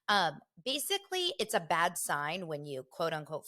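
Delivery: normal talking voice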